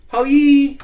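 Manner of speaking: quick light mimic of her phone greeting; a hint of the lady answering the phone; imitating the restaurant lady. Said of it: "she used to say".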